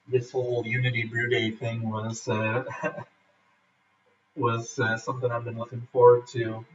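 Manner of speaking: ordinary talk, nothing marked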